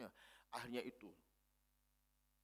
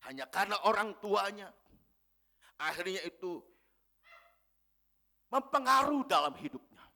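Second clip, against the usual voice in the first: loud, high preaching voice, driving each phrase; full-force preaching; 11 semitones higher, 14 dB louder than usual. Acted. Preacher exhorting the congregation about parents' love, booming. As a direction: proclaim it to the whole hall.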